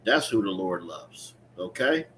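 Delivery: ordinary delivery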